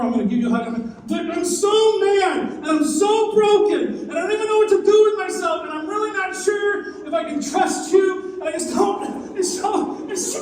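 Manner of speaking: high, loud, strained pleading that cracks into laughter; acted breaking voice. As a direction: play a heartbroken soul barely holding it together.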